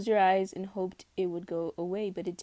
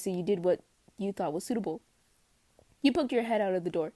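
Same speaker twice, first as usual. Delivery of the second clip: ordinary delivery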